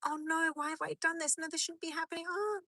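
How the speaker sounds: high, anxious mock panic; panicked dream voice. Dreamer realising a mistake, high mock panic. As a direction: voice climbing in imagined dream dread. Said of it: "You're like".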